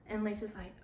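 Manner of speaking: normal talking voice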